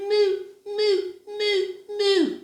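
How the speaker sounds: tiny high-pitched moo; little voice. Acted. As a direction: squeak out feeble little moos. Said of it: "only had a little voice".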